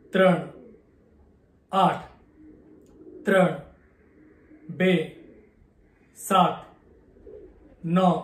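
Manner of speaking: steady, evenly spaced digits with a pause after each; digit recitation. Acted. Reciting pi digits to viewers in Gujarati, a measured recitation. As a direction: count each digit out like a memory feat, one beat apart.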